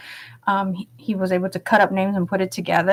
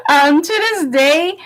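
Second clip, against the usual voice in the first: laughing; 4 dB louder than usual. Real, not acted. Laughs at old nickname.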